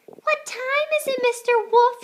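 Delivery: high, loud hen calling; chicken calling out. A narrator's reading. Chicken calling out to the wolf from the door. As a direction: call out like a bold little hen. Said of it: "she called".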